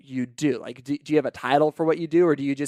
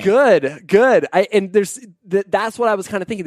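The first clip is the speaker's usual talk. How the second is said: too much caffeine; 6 semitones higher, 5 dB louder than usual. Real, not acted. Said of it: "I'm wired right now".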